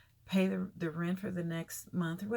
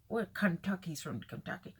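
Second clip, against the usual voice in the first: ordinary delivery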